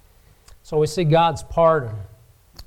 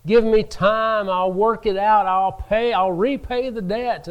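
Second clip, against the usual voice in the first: mildly acted plea, raised pitch; begging; 5 semitones higher, 3 dB louder than usual. Servant begs his master for time, mildly acted pleading. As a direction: plead lightly, as a retold debtor would. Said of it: "he begged his master".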